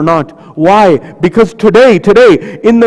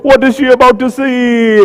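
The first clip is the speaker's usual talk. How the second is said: ordinary talk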